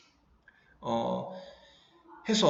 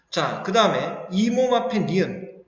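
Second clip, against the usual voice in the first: ordinary talk, nothing marked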